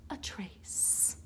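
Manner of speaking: fading whisper. A narrator's reading. Narrator whispering the Lorax vanishing. Story line fades out.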